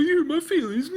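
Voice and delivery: high, laughing fake cry of a hurt monster; mock whimper. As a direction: whine like a crybaby monster. Mocking the whimpering Babadook.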